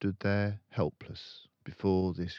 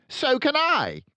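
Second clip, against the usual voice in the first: loud, high snap; curt bully headmistress; snapped; 12 dB louder than usual. A narrator's reading. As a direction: snap it back. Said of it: "Miss Trunchbull snapped".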